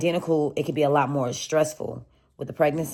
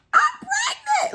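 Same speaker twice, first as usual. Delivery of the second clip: high, slow, stretched-out squeal of joy; excited squeal; slower than usual. Retold or imitated. Finding out at the hospital, she squeals with joy. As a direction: shriek it out in pure delight. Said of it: "I'm excited as hell".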